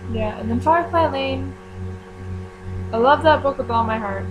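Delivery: normal talking voice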